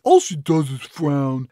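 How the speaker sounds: slow, loud put-on kid complaint; disappointed kid. Kid complains to mom about new cat, mock disappointed. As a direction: whine it slowly as a let-down child. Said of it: "the kid looks quite disappointed".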